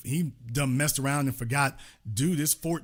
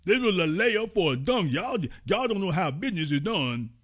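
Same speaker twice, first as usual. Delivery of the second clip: mocking Steve Harvey; 3 semitones higher than usual. Host imitating Steve Harvey excusing his Trump visit, mocking. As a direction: whine it like a sellout justifying himself. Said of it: "When Steve Harvey goes back down there".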